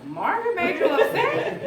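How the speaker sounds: high, laughing disbelief at Margaret made you upset; incredulous laughing echo. Retold or imitated. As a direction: echo it back in amused disbelief. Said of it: "I was".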